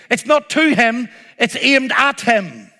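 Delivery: ordinary talk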